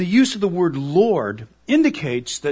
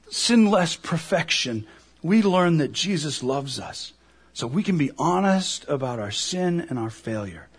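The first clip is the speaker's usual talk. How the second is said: ordinary talk, nothing marked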